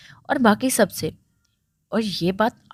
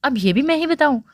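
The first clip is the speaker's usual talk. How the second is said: higher, annoyed, somewhat loud question; raised voice; 6 semitones higher, 3 dB louder, faster than usual. A narrator's reading. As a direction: demand it with mild force. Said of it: "अकरम ने ज़ोर से बोलते पूछा".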